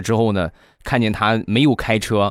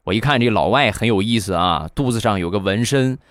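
ordinary delivery

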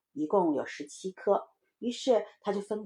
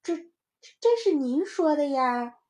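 put-on timid little child; 7 semitones higher, 4 dB louder than usual. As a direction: answer mum back hesitantly. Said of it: "小声说". (小孩怯怯地慢慢辩解)

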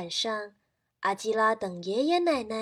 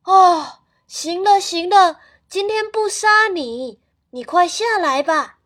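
loud, higher call up into the tree; shouting up; 7 semitones higher, 12 dB louder than usual. Acted. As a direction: grandparents shouting to the rooster in the tree. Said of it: "喊话". (大声喊话)

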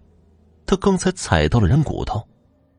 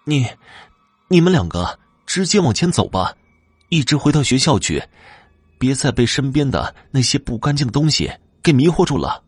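high-pitched, haltingly started plea; frightened; 6 semitones higher than usual. A narrator's reading. Girl urging two bewitched classmates onward, frightened. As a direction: plead anxiously in a raised pitch. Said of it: "雪莉害怕地说道".

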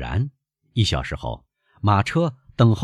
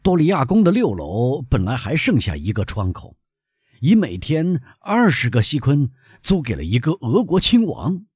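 raised, higher voice for the flustered innkeeper; mildly exclaiming that it cannot be done; innkeeper's cry; 8 semitones higher, 4 dB louder than usual. A narrator's reading. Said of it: "帕斯特里尼老板喊道". (店老板略带激动地喊)

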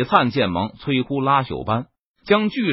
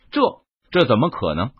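plain reading voice

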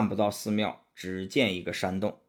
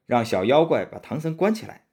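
ordinary delivery, nothing marked

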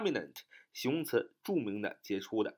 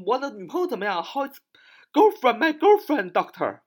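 very high, loud question to the doctor; hospital visitor asking; 12 semitones higher, 8 dB louder than usual. Acted. Boyfriend asking the doctor about girlfriend, high loud query. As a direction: pipe up urgently with the question. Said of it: "这是我们常常去医院问的啊".

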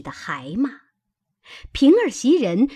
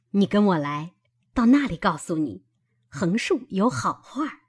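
ordinary delivery

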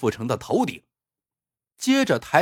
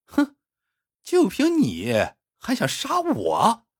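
scornful sneer; 9 semitones higher than usual. A narrator's reading. Villain sneers at beaten man.

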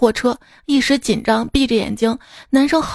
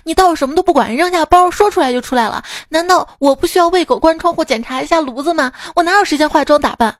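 raised, fast furious rant; angry retort; 5 semitones higher, 3 dB louder than usual. Retold or imitated. Woman snapping at her boyfriend, loud and furious. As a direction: tear into him for the complaint. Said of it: "这女一下怒了".

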